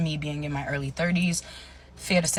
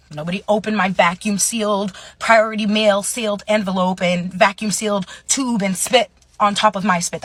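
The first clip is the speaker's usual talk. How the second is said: louder, higher, insistent argument; indignant rebuttal; 5 semitones higher, 7 dB louder than usual. Retold or imitated. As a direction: argue back, hitting each point loudly. Acted rebuttal to grandparents.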